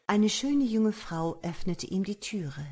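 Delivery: ordinary delivery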